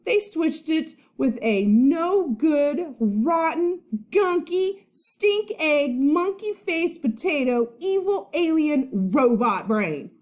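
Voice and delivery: high, loud, slow list of insults; insult tirade. Acted. Boy hurling silly insults at his friend, high and loud. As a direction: spit out each insult. Charlie insulting Eugene, quarrel.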